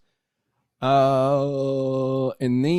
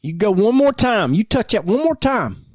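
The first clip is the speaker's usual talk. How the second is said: mock threat; 9 semitones higher than usual.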